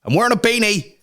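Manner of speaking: high, hyper rambling to the camera; manic camera chatter. Retold or imitated. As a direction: gone stir-crazy, blurting everything out. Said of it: "I'm like".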